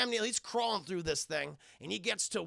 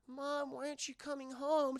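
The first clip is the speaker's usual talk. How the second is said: put-on child voice; 7 semitones higher than usual. Child talks to mother.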